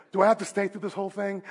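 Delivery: quick, soft, low mock complaint through laughter; amused, weak-voiced grumble; bleeding worshipper. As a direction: imitate a feeble man asking out. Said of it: "imagine the guy bleeding".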